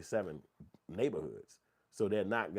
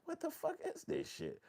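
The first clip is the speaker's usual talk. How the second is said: much higher, slow incredulous voice; slow baffled disbelief; 3 dB quieter than usual. Retold or imitated. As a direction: drag out the confusion. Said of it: "And I was like".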